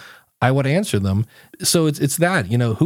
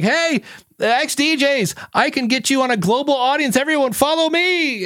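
mock pushy promoter; 13 semitones higher than usual. Barging into the ex-DJ forum as a pushy spammer. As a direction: play the clueless spammer barging in. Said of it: "I walked in and I was like".

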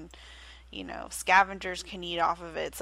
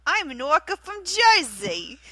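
louder, higher New Jersey accent played up for laughs; mock New Jersey accent; 8 semitones higher, 9 dB louder than usual. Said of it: "can you repeat that accent".

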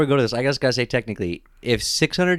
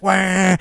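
mock yell; 8 semitones higher than usual. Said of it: "he's like".

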